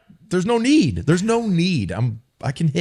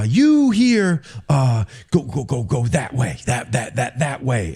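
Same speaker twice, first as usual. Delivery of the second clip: coach impression; 3 semitones higher than usual. Imitating coach Pepper Johnson.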